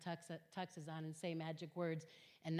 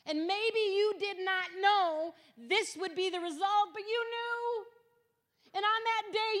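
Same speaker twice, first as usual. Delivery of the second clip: much higher and louder, slow and insistent; strong, firm rebuke; 13 semitones higher, 10 dB louder than usual. Retold or imitated. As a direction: press every point home, loud and deliberate. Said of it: "but strongly".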